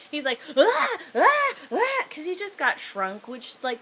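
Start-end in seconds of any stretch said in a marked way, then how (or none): 0.5-2.0 very high, loud mock wails; babyish cries. Shrunken man confronted by the lizard, babyish mock wails. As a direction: squeal like a big scared baby. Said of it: "turns him into a big old baby".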